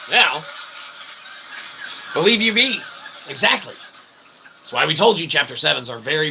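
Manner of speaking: ordinary talk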